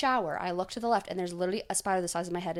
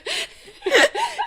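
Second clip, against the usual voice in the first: a very high, loud squeal of laughter; 9 dB louder than usual. Real, not acted. Still cracking up over the host's voice, squealing laugh.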